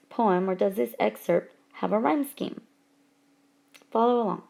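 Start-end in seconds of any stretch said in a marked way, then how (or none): none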